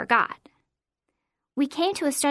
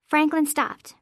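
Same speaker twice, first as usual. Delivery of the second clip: plain reading voice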